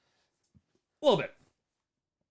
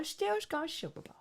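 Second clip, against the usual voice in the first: funny voice; 10 semitones higher, 7 dB quieter, slower than usual. Pretend. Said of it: "That's all you need to know".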